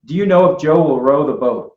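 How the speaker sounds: American O's in Joe, row and boat; American accent. Shown as a demonstration. American teacher demonstrating the O sound. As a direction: read it with American vowels. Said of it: "So I would say".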